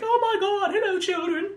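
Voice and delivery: very high, flowery villain. Retold or imitated. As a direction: greet the kids theatrically. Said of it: "flowery and very like".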